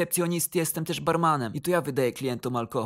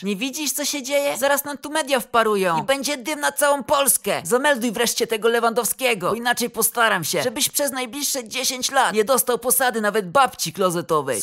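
high, raised-voice furious tirade; red-faced yelling; 5 semitones higher, 4 dB louder than usual. A narrator's reading. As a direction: berate your employee and threaten his job. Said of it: "cały czerwony na twarzy krzyknął do mnie". (wściekła tyrada podniesionym głosem)

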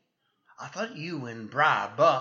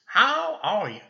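booming, louder hearty hello; too loud greeting; 6 semitones higher, 7 dB louder than usual. Acted. Sheriff loudly greeting the cowboy who is undercover. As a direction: boom out his name for all to hear. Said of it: "Not so loud, Bert".

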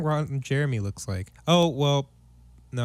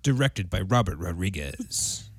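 trailer voice; 7 semitones lower than usual. Pretend. Mock movie trailer narration.

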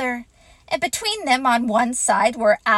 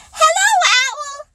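high, excited little squeak; squeaky; 6 dB louder than usual. A narrator's reading. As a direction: squeak it like a tiny eager joey. Roo greets eagerly.

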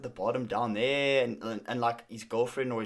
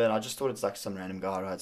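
usual speaking voice

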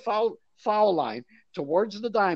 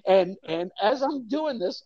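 ordinary talk, nothing marked

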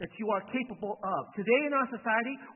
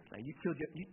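normal talking voice

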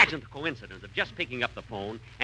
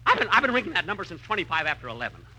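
fast, then higher and louder outrage; rising exasperation; 9 semitones higher, 4 dB louder than usual. Acted. Jack fuming at the operator, louder and higher. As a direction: build to a furious question.

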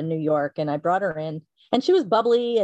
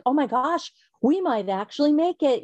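higher-pitched retold hope; excited realization; 7 semitones higher than usual. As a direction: voice lifting with dawning hope. Retelling her dawning hope of success.

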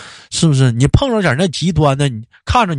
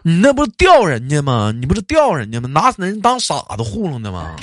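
indignant rant; 8 semitones higher than usual. Real, not acted. Ranting about fake red packets.

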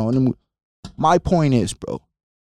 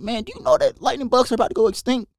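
excited alarm; 12 semitones higher, faster than usual. Retold or imitated. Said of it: "And I was like".

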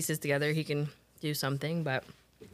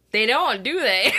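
teasing; 5 semitones higher, 9 dB louder than usual. Retold or imitated. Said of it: "I'm like".

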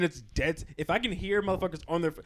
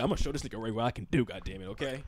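low, fast mumbling impression of a friend; low mumbled imitation; 8 semitones lower, 4 dB quieter than usual. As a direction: mutter it low and quick like mocking him. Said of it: "He said".

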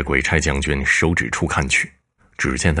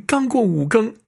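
much higher woman's voice, urgent and worried; anxious wife. Acted. Wife waking her husband in the garden, anxious and high-pitched. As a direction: shake your husband awake in alarm.

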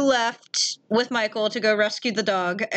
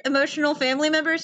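ordinary talk

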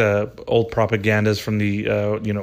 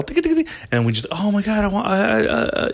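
much higher, quick yappy tick tick; mock excited dog; 10 semitones higher than usual. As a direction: play a dog spotting the shiny bell. Mocking distracted little dogs.

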